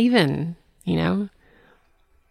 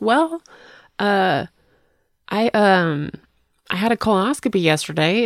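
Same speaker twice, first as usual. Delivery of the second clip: sheepish confession; 6 semitones higher than usual. Retold or imitated. Retelling her own sheepish answer.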